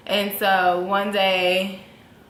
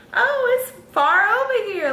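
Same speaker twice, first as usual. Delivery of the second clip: high, slightly louder mock surprise; sonogram lady voice; 14 semitones higher, 4 dB louder than usual. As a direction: play the tech puzzled at the screen, pitched high. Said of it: "And she's like".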